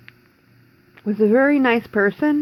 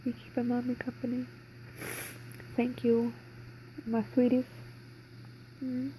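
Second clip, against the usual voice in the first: soft, higher, tender pet talk; talking to puppies; 4 semitones higher, 14 dB quieter than usual. Real, not acted. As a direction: coo gently to the dog. Talking softly to her puppies.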